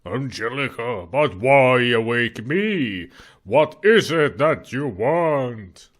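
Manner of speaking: slow, eerie ghost voice; dusty mummy rasp. Acted. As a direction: intone it from beyond the grave. Ghost speaks to wife.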